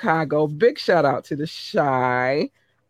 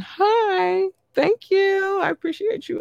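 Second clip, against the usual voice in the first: squeaky greeting; slower than usual. Real, not acted. Squeaky greeting to Switzerland listeners.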